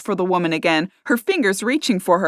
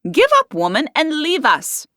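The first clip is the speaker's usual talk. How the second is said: higher, louder sharp dismissal; sharp order; 9 semitones higher, 3 dB louder than usual. A narrator's reading. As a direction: shoo her away sternly. Henrietta ordering barmaid away.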